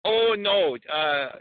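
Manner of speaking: put-on flustered Borat, Kazakh accent. Borat bluffs about Georgia to the host, Kazakh accent.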